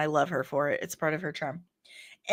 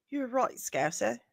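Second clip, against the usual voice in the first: a feeble Liverpool accent attempt, softer; bad Scouse accent; 3 semitones higher, 8 dB quieter than usual. Answering a chat viewer in a bad Liverpool accent. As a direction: try a Scouse accent and fail. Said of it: "That was terrible".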